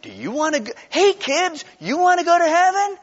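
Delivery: high, hammed-up sales pitch to kids; mock evangelist pitch. Evangelist selling heaven to kids, mock enthusiasm. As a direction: sell it loud and peppy, mockingly. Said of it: "our evangelism sounds a lot like".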